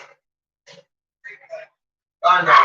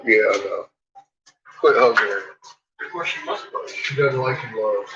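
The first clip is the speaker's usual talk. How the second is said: usual speaking voice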